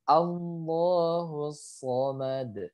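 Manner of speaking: very slow "Allahu somad" with heavy S and released D; thick sod, bounced D. Shown as a demonstration. Teacher models the verse, slow correct "somad". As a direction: hold each syllable, bounce the end of "somad". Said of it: "dia harus dipantulkan".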